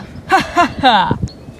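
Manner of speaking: gloating villain chuckle; menacing chuckle; evil laugh. Acted. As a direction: laugh down at the prisoner.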